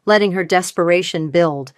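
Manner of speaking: plain reading voice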